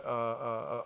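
ordinary talk

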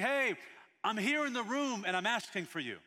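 loud, high, urgent call to someone. Acted. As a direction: call out across the room to get their attention. Voicing the Lord calling out to someone.

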